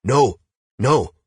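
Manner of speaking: loud, high cries of protest; crying out. A narrator's reading. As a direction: cry out in alarm, each word on its own. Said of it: "And others crying".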